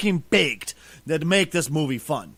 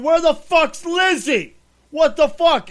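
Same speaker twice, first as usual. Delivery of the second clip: much higher and louder, slow incredulous yell; retold outburst; 10 semitones higher, 7 dB louder, slower than usual. Watching the trailer, he yells in disbelief, higher and louder. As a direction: replay your disbelief at the trailer out loud. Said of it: "and I was going".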